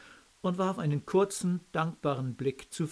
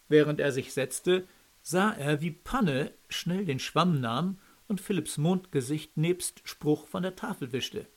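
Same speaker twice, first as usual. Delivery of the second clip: usual speaking voice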